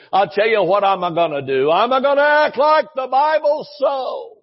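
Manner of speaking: put-on a lifted, folksy old preacher; preacher quote. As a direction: throw it out like the old preacher did. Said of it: "he would say".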